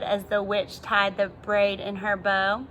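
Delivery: ordinary delivery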